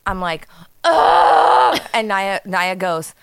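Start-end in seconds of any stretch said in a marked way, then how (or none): 0.8-1.8 loud, high groan of frustration; acted-out exasperated cry. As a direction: let out a fed-up "ugh". Retold fed-up cry at mouse.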